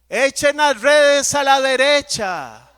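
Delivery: loud, high, slow call across water; Jesus calls to fishermen from the shore, loud and slow. Acted.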